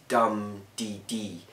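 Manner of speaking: DUM-dee-dee; dactyl beat. Shown as a demonstration. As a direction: lean on dum and let dee dee fall away. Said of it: "the dactyl".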